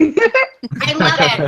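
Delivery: slow, laughing delight; drawn-out happy laugh. Real, not acted.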